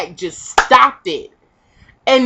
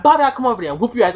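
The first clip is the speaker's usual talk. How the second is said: quick mock threat; 2 semitones higher, much faster than usual. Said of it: "she was like".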